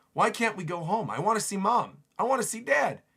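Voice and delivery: lightly acted pleading kid, climbing in pitch; homesick child. Voices homesick children wanting parents.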